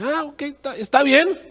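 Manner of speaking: rana aceptando, mucho más aguda; rana convencida. Acted. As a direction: cede con voz aguda de rana. (la rana acepta, con voz aguda)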